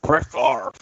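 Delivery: joining the impression bit; mock Madden. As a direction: friend joins the impression teasing. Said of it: "uncanny John Candy of John Madden".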